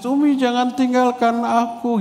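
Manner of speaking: put-on high wailing cry of a child left behind; child screaming. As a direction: cry out to mum, pitched high. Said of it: "pas pulang itu menjerit". (jeritan anak, nada tinggi dan menangis)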